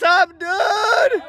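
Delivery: high, slow drawn-out shout; big hyped hello to the guys; excited greeting. Real, not acted.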